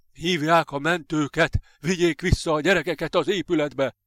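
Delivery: urgent high-pitched shout; shouting orders. A narrator's reading. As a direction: yell back to colleagues. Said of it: "hátra ordított a kollégáinak".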